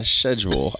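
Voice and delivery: "schedule" as "shed-jool"; sh-pronunciation of schedule. Shown as a demonstration. Host repeating the teased word to co-host, "schedule" as "shed-jool". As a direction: open "schedule" with sh instead of sk. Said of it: "some kind of accent where they say schedule".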